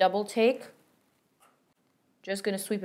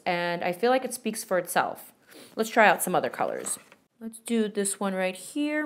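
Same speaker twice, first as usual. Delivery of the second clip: ordinary talk, nothing marked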